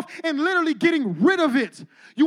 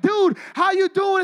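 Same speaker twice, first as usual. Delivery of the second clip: hyped-up greeting; 4 semitones higher, slower than usual. Retold or imitated. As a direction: greet him like a long-lost buddy. Mimicking an overhyped church greeting.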